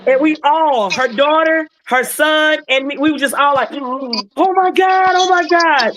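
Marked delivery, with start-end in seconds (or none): 3.7-5.9 high, slow, amazed oh my God; reliving the moment of joy; high stunned exclamation of joy; overjoyed disbelief. Retold or imitated. All overjoyed at her steps.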